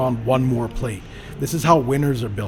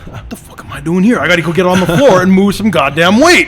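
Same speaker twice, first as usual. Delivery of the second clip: loud, higher self-scolding burst; fired-up outburst; 7 semitones higher, 12 dB louder than usual. Retold or imitated. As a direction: roar at yourself to get moving. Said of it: "I'm just like".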